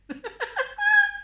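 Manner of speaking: loud laugh shooting way up high. Real, not acted. Vlogger cracking up while explaining her room to viewers, high loud laugh. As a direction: burst into a high squeal of laughter.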